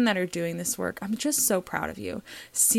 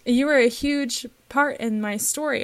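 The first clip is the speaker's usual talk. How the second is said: higher, louder, pushing through real tears; choked up; 5 semitones higher, 7 dB louder than usual. Real, not acted. Host quoting a grateful student's birth story, choked up. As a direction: finish the quote while tearful. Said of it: "oh my gosh, I'm crying".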